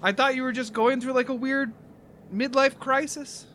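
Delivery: put-on high-pitched supportive girlfriend; Maria. Maria admits she misjudged his band, high girlish voice. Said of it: "she says".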